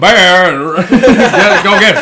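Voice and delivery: slow, laughing Russian accent impression. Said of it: "[name]'s Russian".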